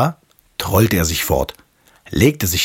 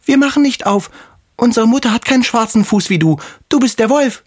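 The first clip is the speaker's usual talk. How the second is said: put-on much higher, louder kid's cry; kids calling out; 3 dB louder than usual. The kids refuse the wolf at the door, high defiant call. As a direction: shout it defiantly like little goats.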